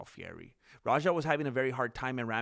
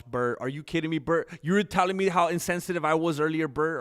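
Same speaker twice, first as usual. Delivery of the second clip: louder, raised, mock-offended callout; loud playful outrage; 5 semitones higher, 8 dB louder than usual. Real, not acted. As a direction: call him out, half laughing. Teasing chatter who called him insensitive.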